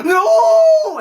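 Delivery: very high, loud drawn-out "no"; mock death scream. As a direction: wail a hammed-up scream of doom. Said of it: "saw his death, like".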